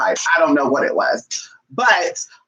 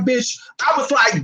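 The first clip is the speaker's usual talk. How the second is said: excited shout; 8 semitones higher, slower than usual. Retold or imitated. Bolting off the bus, relieved.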